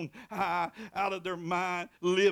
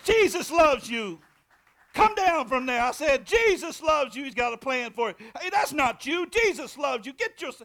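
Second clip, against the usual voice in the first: loud commanding; 8 semitones higher, 11 dB louder than usual. Retold or imitated. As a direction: speak it over him like an exorcism. Commanding the possessed man.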